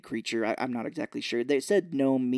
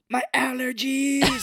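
much higher, slow, hammed-up panic; panicked allergy cry; 11 semitones higher, 4 dB louder, slower than usual. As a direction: wail it as if choking. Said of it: "he's like".

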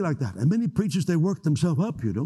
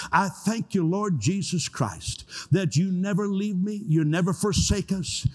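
raised, slower, fervent prayer voice; healing prayer; 5 semitones higher than usual. Acted. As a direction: pray it out over the congregation, lifting up. Leads closing prayer for healing.

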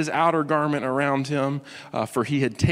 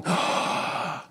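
gasp; 3 semitones higher, 3 dB quieter than usual. Retold or imitated. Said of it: "he is going".

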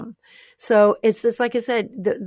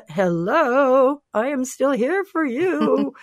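voice of God; 5 semitones higher, much slower than usual. Retold or imitated. God answering her doubts, slow and higher-voiced. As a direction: play God gently reminding her he's still there. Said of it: "God's like".

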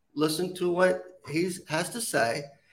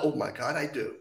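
ordinary delivery

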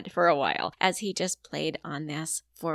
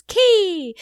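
put-on loud, high toddler shout; 12 semitones higher, 14 dB louder than usual. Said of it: "he says".

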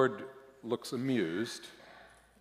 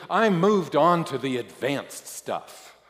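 higher, louder, drawn-out impatient boast; 5 semitones higher, 8 dB louder than usual. A narrator's reading. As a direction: insist impatiently, stretching it out. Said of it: "I say impatiently".

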